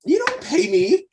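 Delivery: high, loud indignant protest. Acted. Indignant retort in character.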